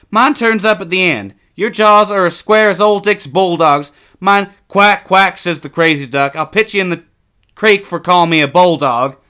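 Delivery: put-on high, loud boyish banter; brash boy comparing faces with his twin; teasing young boy. A narrator's reading.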